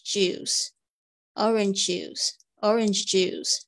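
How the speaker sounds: "orange juice" said very slowly, syllable by syllable; slow "orange juice" for students to copy; teacher drilling "orange juice"; slow model pronunciation. Shown as a demonstration. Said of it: "很快的，orange juice".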